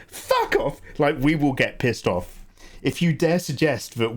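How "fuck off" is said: much higher, squealed mock outrage; squeaky indignant retort. English person snapping back at a class taunt, squealed much higher. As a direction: shoot the pitch way up in mock fury.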